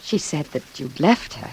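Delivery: measured narrator voice over music; reflective narration. A narrator's reading. First-person narration over music.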